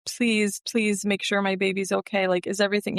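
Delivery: beg, with the voice lifted; begging. Retold or imitated. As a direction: plead anxiously for reassurance. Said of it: "I remember begging".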